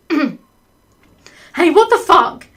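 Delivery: high, loud mock outrage; indignant shout. Caught lover protesting at the camera, indignant yell. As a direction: yell it, hammed-up and affronted.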